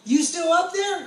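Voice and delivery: high, slow call up to him. Retold or imitated. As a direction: call up to someone above, drawn out. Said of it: "And they're like".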